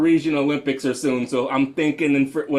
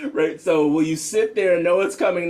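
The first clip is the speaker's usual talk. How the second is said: ordinary talk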